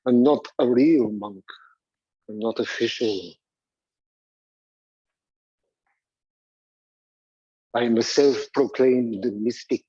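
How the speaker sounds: loom over them as the mad monk; Rasputin. Acted. Teacher playing Rasputin to the class, slow ominous monk. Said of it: "Rasputin was a very weird Russian monk".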